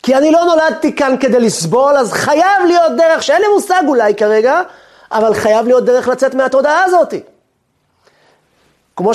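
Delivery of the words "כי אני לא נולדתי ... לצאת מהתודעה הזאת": loud, high desperate plea; raised-voice, impassioned outcry; crying out for help. Acted. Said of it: "והם צעקו לעזרה".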